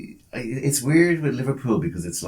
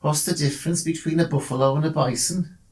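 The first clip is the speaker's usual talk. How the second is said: put-on a light Brummie accent on the joke question; Birmingham accent; 7 semitones higher than usual. Host telling his Birmingham joke, light Brummie accent. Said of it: "has to be done in a Birmingham accent".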